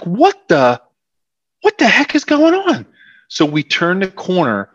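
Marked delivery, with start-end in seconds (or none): 0.0-2.9 higher-pitched retold bewilderment; baffled disbelief. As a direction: act out the players' startled confusion, pitch jumping up. Players baffled by the unexpected roar.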